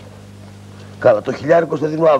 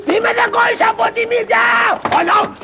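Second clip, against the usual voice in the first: high comic yell of pain. Acted. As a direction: shriek as your nose gets bitten. Nose bitten, yelling in pain.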